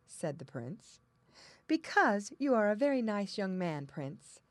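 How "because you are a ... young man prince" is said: theme park princess. A narrator's reading. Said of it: "said the princess".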